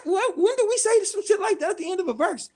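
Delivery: high-pitched baffled protest; incredulous. Retold or imitated. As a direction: question it as if you can't believe your ears. Retold disbelief at a lyric.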